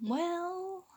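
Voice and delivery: soft, sheepish stammer; stuttering. Acted. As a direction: squirm and hesitate. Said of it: "Marco stuttered with kind of a squirm".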